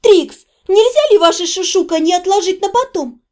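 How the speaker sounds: high, irritated character voice; spitefully voiced interruption. A narrator's reading. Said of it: "Дейла злобно скривилась".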